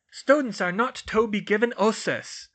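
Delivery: loud, high archaic pronouncement; imperious portrait. Acted. Medieval portrait bars a pupil from entry, imperious. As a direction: lay down the rule loudly.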